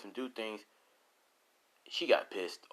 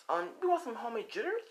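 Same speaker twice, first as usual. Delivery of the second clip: mock sweet offer; faster than usual. Rose taunting her victims.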